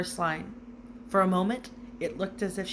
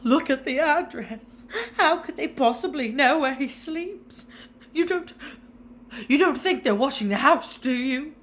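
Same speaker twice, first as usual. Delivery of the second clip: higher, tearful, anxious; quivering voice; 7 semitones higher, 6 dB louder than usual. A narrator's reading. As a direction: fear that someone is watching. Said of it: "in a quivering voice".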